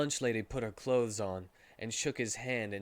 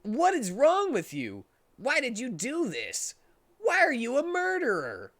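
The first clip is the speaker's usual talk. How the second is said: much higher, louder outraged yelling; furious accusation; 11 semitones higher, 4 dB louder than usual. Acted. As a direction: scream at the murderer in disbelief. Hero confronting the killer.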